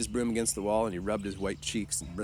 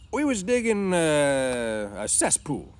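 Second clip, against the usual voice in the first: high, loud start sinking through a long "uh"; improvised excuse; 8 semitones higher, 8 dB louder, much slower than usual. A narrator's reading. New hand inventing a job for the boss, hesitant. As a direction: stall mid-answer, searching for it.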